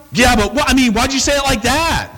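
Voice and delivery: high, needling mock complaint; touchy accusation. Offended spouse accusing the other of a rude tone, high mock whine.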